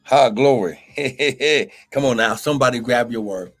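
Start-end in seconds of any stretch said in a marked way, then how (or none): none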